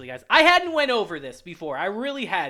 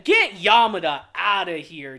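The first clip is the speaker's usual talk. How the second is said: usual speaking voice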